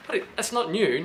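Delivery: pretending to the wife it's old news; mock innocent denial. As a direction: act surprised she'd even ask. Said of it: "I'll say".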